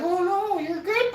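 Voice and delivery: high-pitched mock reassurance; hammed-up high voice waving off worry; reassuring seller, imitated. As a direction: pipe up high as the other guy. Retold seller reassuring him.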